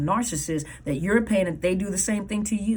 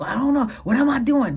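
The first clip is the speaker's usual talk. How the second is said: higher, louder indignant retort; defensive back talk; 7 semitones higher, 5 dB louder than usual. Acted. Youngster answering back when told off, defensive. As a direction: toss it back, high and loud. Acted reply of a scolded person.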